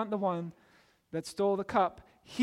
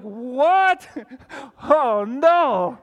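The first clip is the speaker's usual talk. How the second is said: much higher, much louder hammed-up shock, laughing through it; mock panicked gasp; 5 semitones higher, 12 dB louder, much slower than usual. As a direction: play the terrified brothers for laughs.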